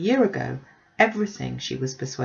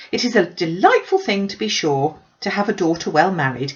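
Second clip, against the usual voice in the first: gushing Mrs Bennet; 3 semitones higher, 6 dB louder than usual. Acted. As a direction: pour out the news like an excited busybody. Mrs Bennet voiced by narrator.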